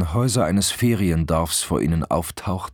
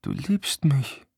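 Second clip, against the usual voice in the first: slow, high, fragile plea; tearful; 10 semitones higher, slower than usual. A narrator's reading. Pregnant girlfriend seeking love from him, tearful. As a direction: plead on the edge of tears. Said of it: "Tränen schossen ihr in die Augen".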